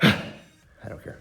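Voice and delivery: breathless and winded; gasping out the words; out of breath. Real, not acted. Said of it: "Sorry, I'm out of breath".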